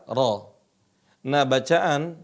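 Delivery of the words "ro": RA with a thin r and open a; ra tipis. Shown as a demonstration. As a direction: keep "ra" light, no O colouring. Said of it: "Tapi dibaca apa?". (ucapkan "ra" tipis dengan A terbuka)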